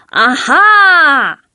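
a loud, high "啊哈" of discovery; loud cry. A narrator's reading. Dodds spots the duck at the movies, loud shout. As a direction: shout it out in the dark cinema. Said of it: "他大叫一声".

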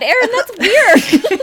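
laughing while teasing; high squeal of laughter. Real, not acted. Laughing at a diet root beer habit.

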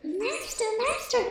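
tiny, very high squeal; piping, high-pitched cry; harp calling out. A narrator's reading. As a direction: call for help as a little magic harp. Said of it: "the harp called out loudly".

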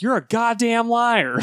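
high, drawn-out mock accusation; mock outraged accusation. Superman hovers up to Lex and accuses him, mock outrage. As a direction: stretch it out high, hamming up the outrage.

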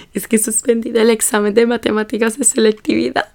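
imitating herself whimpering; mock tearful. Crying student explaining the failed exam to taxi driver, mock tearful. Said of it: "Y yo estaba así llorona".

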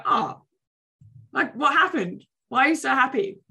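mirrored delight. Retold or imitated. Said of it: "If you look really happy".

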